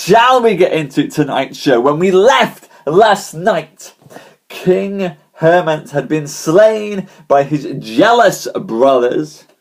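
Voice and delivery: slow, loud, high showman recap; showman storyteller. Acted. Host opening the night's story to viewers, slow showman voice. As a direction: draw the crowd into the tale.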